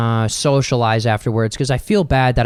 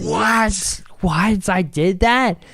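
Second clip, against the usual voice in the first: higher, playful disbelief; mock shocked disbelief; 7 semitones higher, much slower than usual. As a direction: act stunned at the news. Acting shocked at retold news.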